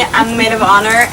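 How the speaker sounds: ordinary talk, nothing marked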